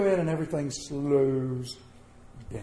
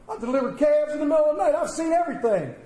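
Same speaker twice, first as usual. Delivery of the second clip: fast, much higher bravado; cocky boast; 11 semitones higher, 4 dB louder, faster than usual. Retold or imitated. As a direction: brag that nothing can shake you. Boasting farm boy toughness.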